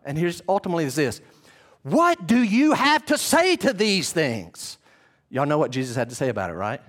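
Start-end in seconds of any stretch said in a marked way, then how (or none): 1.8-4.8 slow, raised demand with weight on say; Caiaphas demanding. Acted. High priest demanding Jesus speak, slow insistent. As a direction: press the question hard and slow.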